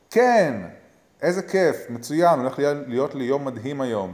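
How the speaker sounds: high, loud burst of enthusiasm, easing slightly; upbeat affirmation. Shown as a demonstration. Coach showing the audience a morning affirmation, loudly enthusiastic. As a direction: pump yourself up for a great day.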